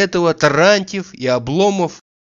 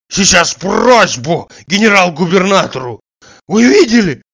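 loud, high, indignant outburst; outraged appeal; 4 semitones higher, 6 dB louder than usual. A narrator's reading. Slapped man threatening a complaint, loud indignant voice. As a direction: burst out, demanding witnesses.

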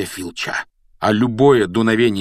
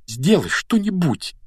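high, drawn-out appeal; urgent, despairing request; desperate plea; 10 semitones higher than usual. A narrator's reading. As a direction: beg for help in despair. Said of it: "в отчаянии попросил он".

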